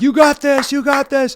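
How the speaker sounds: high cheering encouragement. Retold or imitated. Choir cheering on the soloist, high excited cheer.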